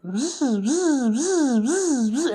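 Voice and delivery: buzzing corduroy rub noise; mimicking the buzz of corduroys rubbing; imitated buzzing. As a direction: buzz like fabric rubbing together. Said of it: "it started making this noise".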